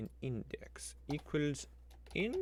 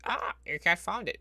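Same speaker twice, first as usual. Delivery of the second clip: mocking the spreadsheet; 7 semitones higher, 7 dB louder than usual. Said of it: "it's like".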